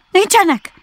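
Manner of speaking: very high, loud squawked reply; irritated Rabbit. A narrator's reading. Rabbit answering an unwanted caller from the burrow, high irritated shout. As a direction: shout it back in a high character voice.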